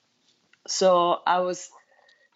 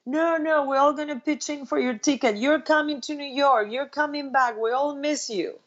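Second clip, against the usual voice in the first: much higher, eager group pleading; friends insisting; 7 semitones higher than usual. Retold or imitated. Friends pitching in for her ticket, eager insistence. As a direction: sell it like friends begging her back. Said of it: "all my friends were like".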